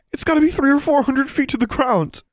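very high, upset protest; distraught boy. A narrator's reading. Crying boy tells heroine how far girl fell, distraught. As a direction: cry it out in despair.

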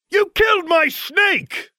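high, slow, furious yell at the one who killed his pet; enraged shout. Acted. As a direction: scream it out, drawn-out and outraged. Harry enraged at dead snake.

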